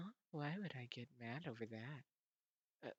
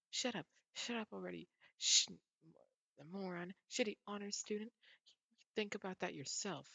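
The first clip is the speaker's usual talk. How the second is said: louder, higher, snapping insults; angry outburst; 6 semitones higher, 6 dB louder than usual. Acted. Vampire girl snapping at the boy who annoys her, angry. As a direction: lash out at him, fed up.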